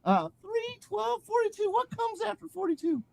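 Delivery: high falsetto nonsense, building to a loud finish; LaFranc babbling. Acted. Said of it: "As LaFranc began to make less sense".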